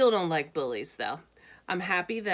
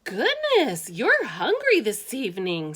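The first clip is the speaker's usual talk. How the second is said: high, louder surprised mother; mother's voice; 5 semitones higher, 6 dB louder than usual. Acted. Mother surprised at her son's appetite, high voice. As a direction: exclaim like a fussing mom.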